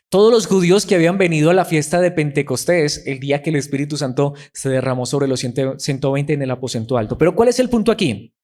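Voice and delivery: ordinary talk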